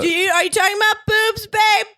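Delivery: high, quick shouted question; suspicious wife calling. Acted. Hera interrupts her husband's talk, high suspicious holler. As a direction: holler in accusingly from the next room.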